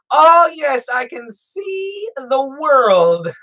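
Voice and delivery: hammed-up awe as if seeing clearly for the first time; mock amazement. Joking that his boring glasses reveal the world, in mock awe.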